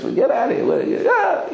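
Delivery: hammed-up high indignant yell; mock outrage. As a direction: shoo him out in mock outrage. People ejecting naked man.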